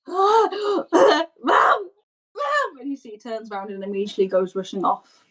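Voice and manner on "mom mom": put-on high, tearful child's call; crying for mother. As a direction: call for mom in a high, panicked voice. Boy calls out for his mother.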